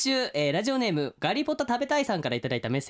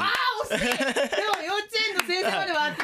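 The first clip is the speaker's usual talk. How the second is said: laughing; 12 semitones higher than usual. Real, not acted. Reacting to the near-miss answer.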